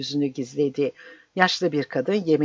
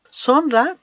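high, louder prodding question; impatient; 10 semitones higher, 5 dB louder than usual. A narrator's reading. Mandras interrupts the priest's long tale, impatient. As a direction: push the speaker on impatiently. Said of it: "dedi Mandras Baba sabırsızlıkla".

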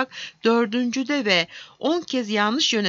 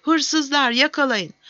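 raised, higher voice of an angry dwarf; angry shout; 6 semitones higher, 4 dB louder, slower than usual. A narrator's reading. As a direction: cry thief, in a storyteller's mild yell. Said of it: "diye bağırdı cüce kızgınlıkla".

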